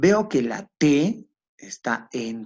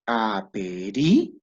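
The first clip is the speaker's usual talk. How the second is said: Aperire split slowly into syllables, a-pe-ri-re; slow teacher's syllable-by-syllable Aperire; syllabified slowly; 3 semitones lower, much slower than usual. Shown as a demonstration. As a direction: spell out Aperire syllable by syllable for the class. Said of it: "Fíjate, lo silabeamos".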